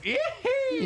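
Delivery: put-on high cackling old-timer whoop; old prospector whoop. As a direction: squeal like a crazy old prospector. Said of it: "in just long underwear going".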